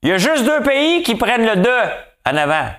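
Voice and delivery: high, bright, animated calling; playful high voice. Acted. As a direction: excitedly tell your partner the news. Acted talk to spouse, high voice.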